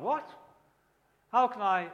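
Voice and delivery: sharply rising question of disbelief; acted disbelief; incredulous. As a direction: blurt it back as a baffled listener. Acted baffled listener to Jesus' claim.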